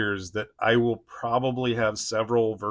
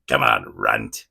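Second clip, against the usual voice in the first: very low, loud, drawn-out growl; silly cartoon villain, pitched way down; Sabretooth cartoon voice; 13 semitones lower, 5 dB louder than usual. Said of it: "His voice makes me cringe".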